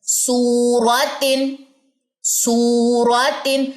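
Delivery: سورتن drawn out slowly with the long و held; long vowel. Shown as a demonstration. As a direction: hold the و in سورتن for two seconds. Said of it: "اس کو لمبا کرنا ہے". (سورتن کو لمبا کر کے پڑھنا)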